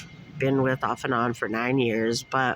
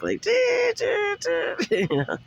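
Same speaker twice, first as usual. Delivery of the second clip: high, slow eerie hum; mock scary music; much slower than usual. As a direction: hum spooky movie music. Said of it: "some scary music".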